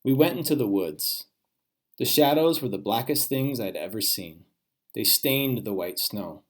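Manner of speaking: ordinary delivery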